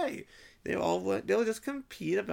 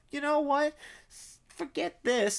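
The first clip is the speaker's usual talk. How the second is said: higher, louder brush-off; mock exasperated outburst; fed-up dismissal; 7 semitones higher, 4 dB louder than usual. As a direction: toss the whole idea aside. Acting out giving up on pausing.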